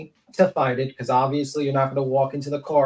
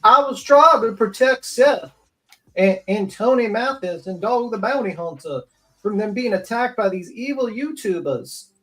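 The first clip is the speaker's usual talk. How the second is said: raised whiny mock voice; mocking Nick; 7 semitones higher, 5 dB louder than usual. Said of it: "make up some bullshit reason, like".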